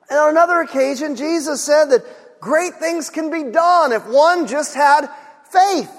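ordinary talk

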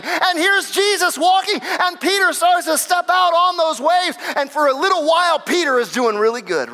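high-pitched, driving sermon delivery; impassioned preaching. Real, not acted. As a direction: preach it with rising urgency. Preaches at full force.

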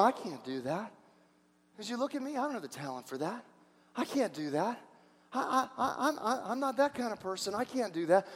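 soft, hesitant protest; self-doubting Gideon. Acted. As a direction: back away quietly, stammering a little. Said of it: "And like Gideon, you're going".

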